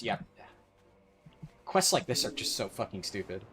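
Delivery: usual speaking voice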